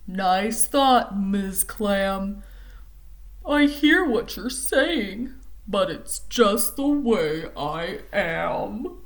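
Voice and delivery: a touch slow, lightly voiced sulky fish; glum pout fish. The pout fish answers Miss Clam, slow and glum. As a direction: shrug it off, drag the words.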